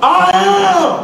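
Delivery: very high, loud rallying cry; all-out shout. Shown as a demonstration. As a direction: belt it out with everything you've got. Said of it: "I'm gonna give it everything I got".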